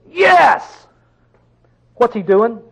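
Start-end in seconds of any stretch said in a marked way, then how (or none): 0.1-0.9 Yes! shouted much higher and louder; emphatic yes. Shown as a demonstration. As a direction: burst out Yes! with full emphasis.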